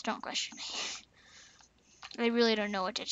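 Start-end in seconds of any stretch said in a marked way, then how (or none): 0.0-0.6 breathy whispered aside, dead serious; mock secretive whisper. Gamer to his viewers, a playful whispered aside. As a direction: whisper it like a secret you won't explain.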